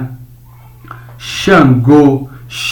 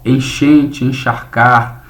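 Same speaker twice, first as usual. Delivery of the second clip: slow example word; slower than usual. Shown as a demonstration. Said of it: "teacher models the word".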